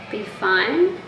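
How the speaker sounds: ordinary talk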